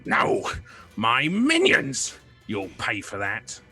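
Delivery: slow, menacing villain threat; lightly voiced villain threatening. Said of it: "figure behind him go".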